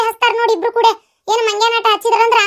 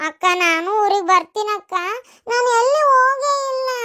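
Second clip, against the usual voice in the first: tearful pleading. Acted. Younger sister begging elder sister to take her, crying. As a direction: draw out the begging through tears.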